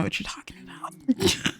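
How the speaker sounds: coy whispered denial; hushed fake-innocent reply to the nosy question. Sim voiced as coy denial.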